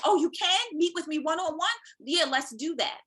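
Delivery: high, bright, grateful acceptance of an offer; eager delight. Retold or imitated. Mother accepting one-on-one help, high eager delight. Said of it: "I'm like".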